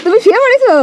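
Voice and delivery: high amused disbelief; surprised. Real, not acted. Grandpa lay down in shop.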